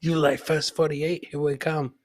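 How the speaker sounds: ordinary talk, nothing marked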